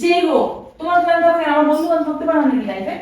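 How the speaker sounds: heated, rapid self-defence; indignant retort. Pretend. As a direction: fire back in a staged quarrel. Quarrels with husband over the caller.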